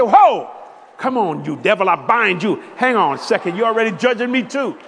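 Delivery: very loud mock rebuke of the devil; loud comic protest. Husband fending off his wife's scolding, loud mock rebuke. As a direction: push back hard, half joking. Preacher retorts to wife loudly.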